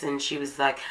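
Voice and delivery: normal talking voice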